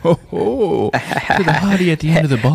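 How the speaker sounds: slick pickup line; flirty barfly. Pretend. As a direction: toast the hottie, smooth. Playing barfly ordering.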